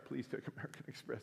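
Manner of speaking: whispered, under the breath; hushed desperate whisper to himself; whispered plea. Retold or imitated. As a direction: pray it under your breath. Anxious aside while paying.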